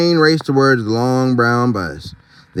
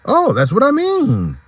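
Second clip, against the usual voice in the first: high zebra voice; 13 semitones higher than usual. Acted. Zebra answers Max.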